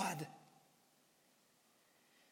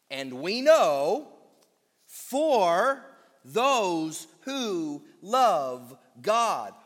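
very slow and louder, each word stretched; slow weighted reading; 5 dB louder, much slower than usual. Acted. Preacher reciting the verse once more, very slow. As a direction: let the verse hang word by word.

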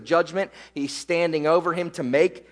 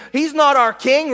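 raised, louder crowd protest; mock scream; 8 semitones higher, 5 dB louder than usual. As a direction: cry out like an objecting crowd. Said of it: "they're gonna scream".

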